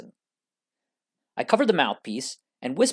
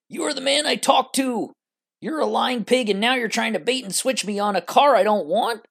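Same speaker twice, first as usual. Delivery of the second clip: higher-pitched outraged customer, not at full volume; angry shouting; 7 semitones higher than usual. Acted. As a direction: raise the pitch in a narrated angry outburst.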